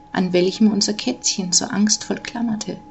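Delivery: ordinary delivery, nothing marked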